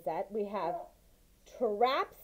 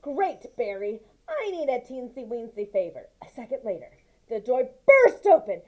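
lively, raised read-aloud voice for the mouse tale; story narration; 4 semitones higher, 9 dB louder than usual. A narrator's reading. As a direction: tell it like a bedtime story, with energy. Continues reading the story.

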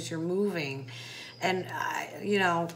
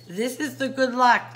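higher, louder and cheerful, lifting on luck; bright upbeat retake; 5 semitones higher, 7 dB louder than usual. Shown as a demonstration. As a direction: announce it as happy news. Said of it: "let me say".

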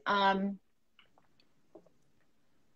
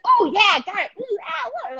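mock gamer yelps, pitched up high; imitating a screaming kid; 10 semitones higher than usual. A child playing games on the flight, mock screaming. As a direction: ape a child shrieking at a video game. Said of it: "Like, screaming".

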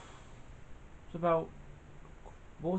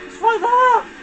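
triumphant yell; 11 semitones higher than usual. Real, not acted. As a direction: scream it at the finish. About to win race.

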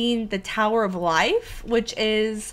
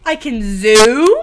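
surprised; 13 dB louder, slower than usual. Real, not acted. Discovering zoom in the game.

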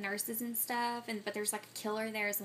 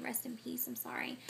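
hushed whisper; 2 semitones higher, 8 dB quieter than usual. Real, not acted. Hushed tribute to dead actor.

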